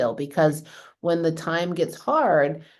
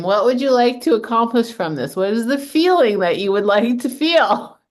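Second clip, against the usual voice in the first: louder, higher, playful mock therapist questions; joking she should coach her husband at the dryer, higher and louder; 6 semitones higher, 7 dB louder than usual. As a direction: tease him like a life coach would. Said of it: "You should just ask him".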